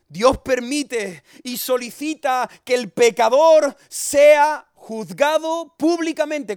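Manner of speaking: high, loud pulpit thundering; full-force sermon shout; fiery preaching. Acted. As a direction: preach it out to wake the whole neighbourhood.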